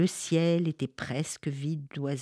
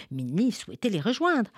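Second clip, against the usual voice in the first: usual speaking voice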